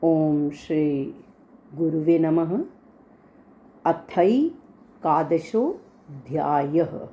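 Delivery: slow, low Sanskrit invocation chant; recitation. Acted. Teacher opening the Gita class with a slow chant. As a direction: intone the opening prayer slowly.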